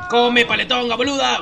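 loud teasing yell at a friend; shout. Real, not acted. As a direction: yell it out mockingly.

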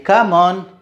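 usual speaking voice

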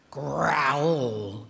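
a bear's growl, voiced in an odd timbre; papa bear growl. A narrator's reading. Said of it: "growled the papa bear".

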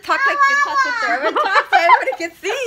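high, loud, fast lisping voice; silly lisping sister voice for the vlog; sister talk voice. Pretend. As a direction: lisp every s in a silly high voice. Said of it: "Me and my sister talk like this".

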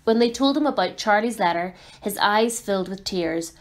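plain reading voice

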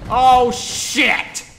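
loud, high panicked swearing; alarmed shout. Real, not acted. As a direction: yell it as things suddenly go wrong. Fire goes wrong in game.

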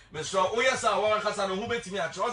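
ordinary talk

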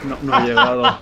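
high laughing admission. Real, not acted. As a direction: laugh as you say it. Laughs after missing the kill.

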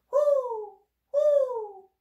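high, loud hooting; owl hoots. A narrator's reading. Brothers and sisters call in the night, high loud hoots. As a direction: hoot out a long, falling owl call. Owl family heard calling.

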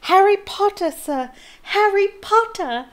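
high, loud elf squeal. A narrator's reading. Small elf hurtling toward Harry, squealing. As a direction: squeal the name in overjoyed greeting.

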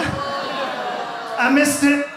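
a long drawn-out groan of regret; slow lament. Real, not acted. Comic telling the crowd, slow drawn-out regret. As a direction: stretch it out slowly, mourning the missed chance.

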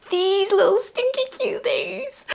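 high, slow gushing squeal. Real, not acted. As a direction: squeal over something adorable. Gushing over tiny socks.

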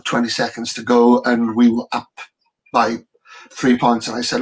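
ordinary delivery

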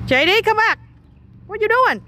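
high-pitched calling voice. Real, not acted. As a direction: call them back, voice pitched well up. Calling to one running off. (gọi với theo, giọng cao vút)

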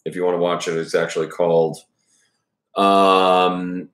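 ordinary delivery

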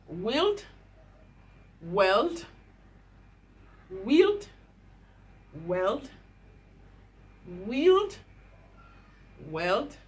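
rising "wield" against flat "weld"; contrast drill. Shown as a demonstration. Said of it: "Let's put all the words together now".

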